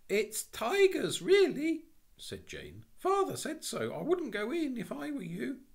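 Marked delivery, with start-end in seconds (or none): 0.1-1.8 put-on very high, loud child's warning; piping girl insisting urgently; slow, high-pitched cry of warning; alarmed little girl. Girl warns policeman of danger. 3.0-5.6 put-on very high, loud child pleading; piping girl's earnest warning; high-voiced child insisting; alarmed little girl. Girl warns policeman of danger.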